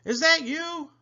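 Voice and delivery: very high, hopeful happy question; Stanley, whistling happily. A narrator's reading. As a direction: ask it in a happy high call. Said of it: "he whistled happily".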